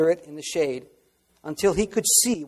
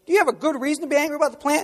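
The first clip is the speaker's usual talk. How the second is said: pressing question; 10 semitones higher, much faster than usual. Acted. God pressing Jonah again with the same question. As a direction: fire the question back at him twice as fast. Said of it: "Again with that question".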